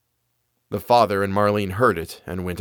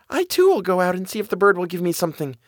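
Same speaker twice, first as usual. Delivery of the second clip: put-on high, quick girl's voice; Marlene; 8 semitones higher, faster than usual. Sister hoping for the bird's gift, high quick voice. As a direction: say it high and fast, eager to go.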